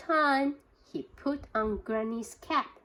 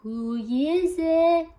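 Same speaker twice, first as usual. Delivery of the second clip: put-on slow, higher old granny calling through the door; 6 semitones higher, 9 dB louder, slower than usual. A narrator's reading. Granny calling to the knock at her door, slow and high. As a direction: call out slowly as Granny. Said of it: "called out Granny".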